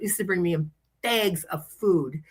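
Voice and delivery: ordinary delivery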